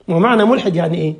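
ordinary talk, nothing marked

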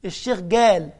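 "الشيخ جال" with g for qaf, loud; Saidi g for qaf. Shown as a demonstration. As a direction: voice it like a villager from Upper Egypt. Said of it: "يعني مثلا يقول لك". ("الشيخ جال" بالجيم الصعيدية)

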